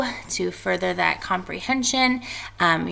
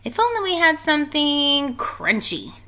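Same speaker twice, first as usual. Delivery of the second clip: higher witch longing for crunch; wistful witch; 9 semitones higher, 3 dB louder than usual. A narrator's reading. Teacher reading, Naggy Witch wishing for something crunchy. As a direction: wish aloud for something crunchy. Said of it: "said Naggy Witch".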